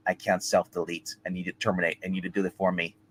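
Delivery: low, subdued imitation of a movie cyborg; hushed, low-pitched retelling of a solemn film line; Arnold as the Terminator. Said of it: "Arnold turns to her and's like".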